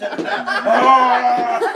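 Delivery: loud, slow words through laughter; can barely get it out for laughing; laughing hard. Real, not acted. Recalling scaring Rhonda in the van, laughing loud.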